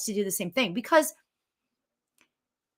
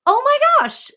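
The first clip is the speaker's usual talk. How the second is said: high, loud delighted oh my gosh; excited gasp; 6 dB louder than usual. Retold or imitated. Host acting her own relief at a better affirmation, high and loud. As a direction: burst out in happy relief.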